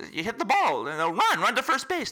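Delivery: acted exasperated coaching from the stands; urgent urging. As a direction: cheer on a runner who won't budge. Onlookers urge the horse to run.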